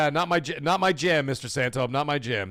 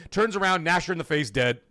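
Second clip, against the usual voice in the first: usual speaking voice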